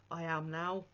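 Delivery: a real catch in the throat; getting choked up mid-sentence. Real, not acted. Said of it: "I get all choked up".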